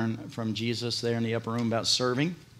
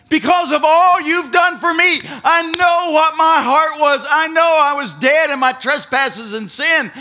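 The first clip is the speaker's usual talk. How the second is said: loud, high impassioned preaching; loud preacher leading the prayer; full-force preaching; 10 semitones higher, 10 dB louder than usual. Real, not acted.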